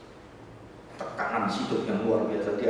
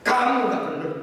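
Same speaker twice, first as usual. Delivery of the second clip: high, raised rebuke; angry father; 13 semitones higher, 7 dB louder than usual. Retold or imitated. Father dismissing his son's excuse, angry rebuke. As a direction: cut the son down sharply. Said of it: "dia marah-marah pada anaknya".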